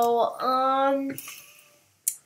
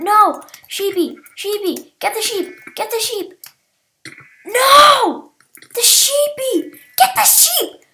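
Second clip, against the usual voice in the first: high, loud desperate shouts; frantic yelling; 10 semitones higher, 9 dB louder than usual. Real, not acted. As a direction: scream as the sheep slips away. Sheep being stolen.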